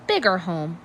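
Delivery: ordinary delivery, nothing marked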